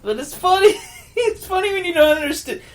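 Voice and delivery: talking through high laughter; laughing. Real, not acted. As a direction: giggle through the words. Laughing at foreign audio.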